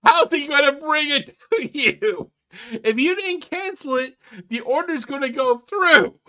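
loud, high-pitched incredulous reasoning; exasperated yelling. Real, not acted. Commentator arguing back at the ranting caller, exasperated yell. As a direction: raise your voice in sheer exasperation.

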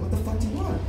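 high, baffled outburst; exasperated billionaire. Acted. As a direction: throw up your hands, high and incredulous. Billionaire loses patience.

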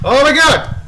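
loud, high exasperated outburst; frustrated shouting. Real, not acted. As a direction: yell at whoever is blocking you. Blocked by game pursuer.

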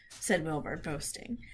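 ordinary delivery, nothing marked

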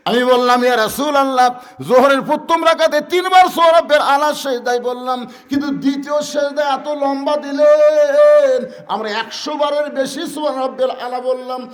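loud, high shouted sermon; fervent shouted waz delivery; preaching at full force. Acted. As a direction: cry it out to the congregation. Preaching climax, shouted narration.

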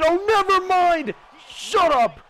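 rising to a high, stretched yell; excited shouting. Real, not acted. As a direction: burst into a loud, high shout. Sudden knockdown during game.

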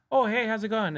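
high, chirpy realtor hello; realtor phone greeting. Acted. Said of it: "You know how to speak realtor".

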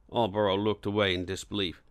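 plain reading voice

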